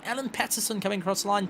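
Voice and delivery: ordinary talk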